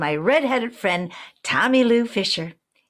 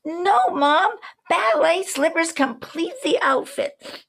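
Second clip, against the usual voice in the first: put-on higher, slow, insistent child's voice; stubborn little girl; 9 semitones higher, slower than usual. Little girl refusing her mom, high and stubborn. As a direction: dig in your heels like a small girl. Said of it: "I was already a stubborn little girl".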